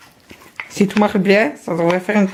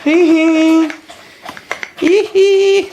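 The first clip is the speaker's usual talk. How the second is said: high giggle; 8 semitones higher, much slower than usual. Real, not acted. Recipe seems to have failed.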